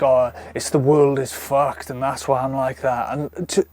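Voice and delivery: ordinary delivery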